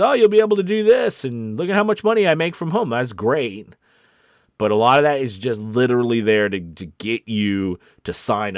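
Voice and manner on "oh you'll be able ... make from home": high, loud hammed-up sales pitch; mock online hype. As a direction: imitate an over-eager internet promoter, pitched way up. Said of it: "stuff online that says".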